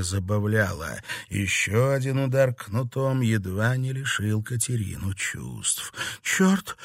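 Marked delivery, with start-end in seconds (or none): 6.2-6.8 high, strained curse; stifled cry of pain; pained groan. A narrator's reading. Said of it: "простонала девушка".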